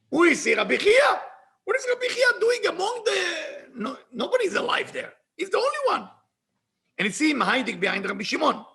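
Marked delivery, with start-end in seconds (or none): 0.1-6.1 high, loud outburst of alarm; agitated disbelief. Retold or imitated. Shaken Messiah spotting a living human, agitated disbelief. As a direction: cry it out, acting someone aghast at the sight.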